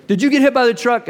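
high, loud gruff question; dad checks his son in mild alarm; big dad asking. Retold or imitated. Dad asking his son on the ground, loud and high. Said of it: "and he goes".

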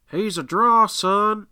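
high, loud commentator's call slowing right down; Jim Ross voice. Retold or imitated. Fan doing Jim Ross at the screen, hyped commentator call. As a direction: hype him up like Jim Ross calling a wrestler. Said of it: "in my best, um, Jim Ross voice".

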